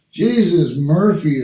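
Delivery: a loud, punchy Jesus Murphy; borrowed oath, tossed out loud; exclaimed. Retold or imitated. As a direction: blurt out Jesus Murphy like a friend would. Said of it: "as a friend of mine would have said".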